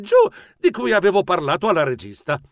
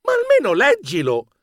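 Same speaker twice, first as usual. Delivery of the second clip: much higher pleading insistence; insisting; 12 semitones higher, 3 dB louder than usual. A narrator's reading. Narrator urges his cousin to read the note, high and insistent. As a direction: beg him to read it. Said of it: "Dovetti insistere".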